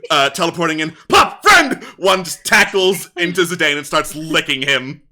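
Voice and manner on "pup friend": high, loud yapped single words; short high-pitched talking-dog yips; barking dog. Acted. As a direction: bark each word out like an excited dog. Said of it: "a bunch of other barks echoing".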